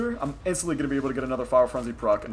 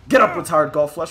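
ordinary talk, nothing marked

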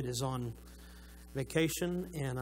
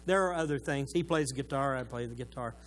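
ordinary delivery

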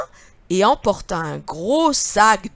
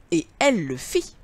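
plain reading voice

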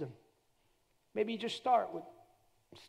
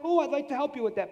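higher, louder, eager offer of help; God's sympathetic reply; 7 semitones higher, 7 dB louder than usual. Acted. Preacher voices God offering to help a worrier, sympathetic reply. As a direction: speak up higher as a willing helper.

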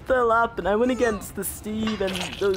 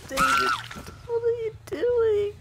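laughing hard. Real, not acted. Teammate's blunder, cracking up.

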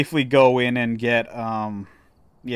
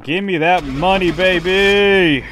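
higher, louder gloating call; gleeful cheer; 6 semitones higher, 5 dB louder, slower than usual. Real, not acted. Gamer gloating over the contract payout, gleeful cheer. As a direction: demand the cash with glee.

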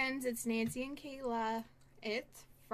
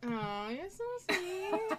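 tender aw; 7 semitones higher, slower than usual. Real, not acted. Touched by mom's remark.

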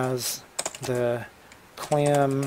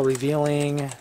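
ordinary delivery

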